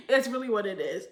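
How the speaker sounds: laughing through the words. Real, not acted. Recapping a teacher crush, laughing it off. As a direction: let a laugh carry the line. Laughing at her own joke.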